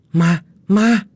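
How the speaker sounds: loud, high cry of alarm; startled scream. A narrator's reading. Du waking at night from a ghost, startled scream. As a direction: yell it out high and sudden. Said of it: "Du thét lên giữa đêm".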